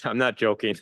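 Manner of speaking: laughing through the words. Real, not acted. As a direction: break into a real laugh. Laughs at his own remark.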